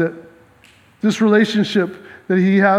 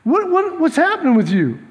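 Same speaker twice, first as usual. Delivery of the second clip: God's concern; 6 semitones higher than usual. Retold or imitated. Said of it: "God comes to him and says".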